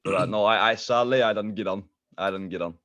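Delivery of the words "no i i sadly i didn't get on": ordinary delivery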